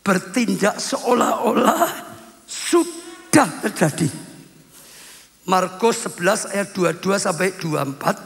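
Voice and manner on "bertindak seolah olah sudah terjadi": drawn-out phrase with deliberate breaks; slow emphatic repeat. Shown as a demonstration. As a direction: spell the lesson out slowly. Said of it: "Saya ulang ya". (ulangan perlahan dengan penekanan)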